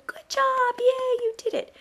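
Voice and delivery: put-on high, bright cheer for the child; excited praise. Shown as a demonstration. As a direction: cheer them on like they just won. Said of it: "and you go".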